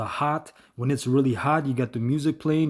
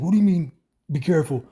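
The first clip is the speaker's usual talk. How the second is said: angry retort; 4 semitones higher, 4 dB louder than usual. Retold or imitated. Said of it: "and he got mad".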